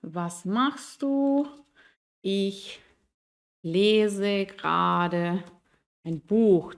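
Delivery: very slow question, then a falling answer. Shown as a demonstration. Teacher models a question and its answer. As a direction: rise on the question, fall on Buch. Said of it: "podríamos responder acá".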